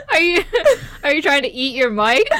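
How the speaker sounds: ordinary talk, nothing marked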